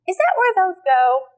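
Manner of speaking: high, loud, slow singsong tease; playful teasing. Shown as a demonstration. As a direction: play silly with a baby. Teasing a baby with socks.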